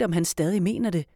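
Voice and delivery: ordinary delivery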